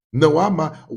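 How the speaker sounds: normal talking voice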